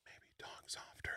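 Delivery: whispered, breathy aside; hushed whisper. Retold or imitated. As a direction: drop to a whisper to demonstrate. Quoting advice to talk softer.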